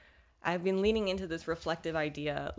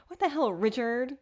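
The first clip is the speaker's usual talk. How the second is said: higher and louder, fed-up cry; exasperated outburst; 6 semitones higher, 4 dB louder than usual. Retold or imitated. Vlogger voicing her fed-up self at bad luck, high and loud. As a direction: throw it out in exasperation. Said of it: "you know the sound".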